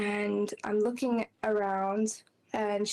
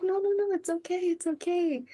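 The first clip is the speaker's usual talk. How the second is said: reassuring friend; 9 semitones higher than usual. Retold or imitated. Friend comforting her as she cries.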